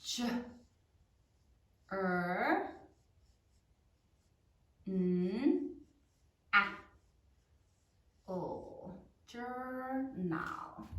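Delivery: J, er, n, a, o softly, long pauses between; low, drawn-out single sounds ending in "Journal"; sounding out while writing. Shown as a demonstration. Teacher writes along with class, softly sounding out. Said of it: "Say it out as you write it".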